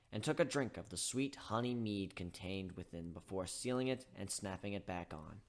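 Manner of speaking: ordinary delivery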